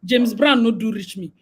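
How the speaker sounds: usual speaking voice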